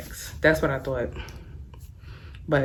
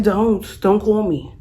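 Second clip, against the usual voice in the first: higher, louder snap of a refusal; retold angry refusal, pitched up and louder; angry retort; 5 semitones higher, 5 dB louder than usual. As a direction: throw the refusal back at him, heated. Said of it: "I was like".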